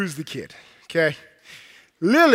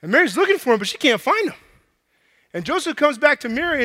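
ordinary delivery

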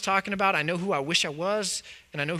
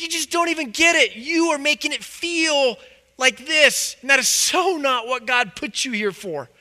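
angry rebuke; 6 semitones higher than usual. Acted. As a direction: let the anger rise and shout the last line. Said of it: "And Jesus is so angry with it".

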